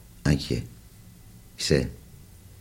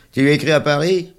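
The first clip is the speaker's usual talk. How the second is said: put-on high, loud kid's taunt; sing-song the name to tease; mocking child voice; 11 semitones higher, 8 dB louder than usual. A narrator's reading. Charles teasing the little one about his letter, high and loud.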